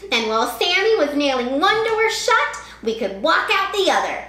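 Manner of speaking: put-on a clever kid's comeback; boy's voice. A narrator's reading. Kevin jokes with his dad, lightly voiced boy. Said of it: "Kevin said".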